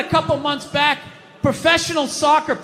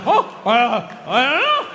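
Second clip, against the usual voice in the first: dumbfounded stammer. Retold or imitated. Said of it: "They had to sit there, like, dumbfounded".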